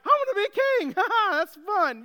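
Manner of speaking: put-on very high, loud cocky kid voice with a laugh; a squeaky teen bragging he'll be king, laughing; young David as a squeaky teen laughing off being king. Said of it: "squeaky voice and all and acne".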